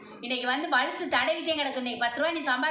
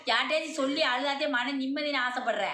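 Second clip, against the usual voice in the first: possessed goddess voice. Acted. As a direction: proclaim as the mother goddess in trance. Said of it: "அம்மா அருள்ல வந்து சொல்லிச்சு".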